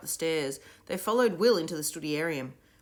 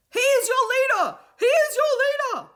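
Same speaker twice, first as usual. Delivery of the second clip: high, loud chanted shout; 12 dB louder than usual. A narrator's reading. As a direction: cheer him on to the crowd. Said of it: "shouted Davina and Stanley".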